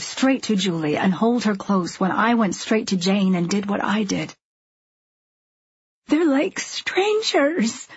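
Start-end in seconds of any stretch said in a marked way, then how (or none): 6.1-7.9 high, very slow lament; half-whispered anguished wail; tearful. A narrator's reading. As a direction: draw it out high, close to tears. Distraught wife pleads with her husband.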